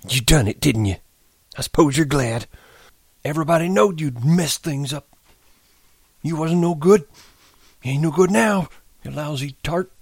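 put-on high, bitter old man; sorrowful anger. A narrator's reading. Candy blames Curly's wife, vicious and sorrowful. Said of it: "his anger grew into words".

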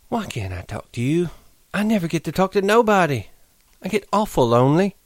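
high pleading woman's voice; Curly's wife, lonely. Acted. Curly's wife pleads her loneliness to Lenny. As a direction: beg him to listen to her.

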